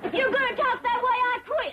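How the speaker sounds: high, indignant retort; offended anger. Acted. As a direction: threaten to walk out, pitch jumping up. Quarrel after being called dumb.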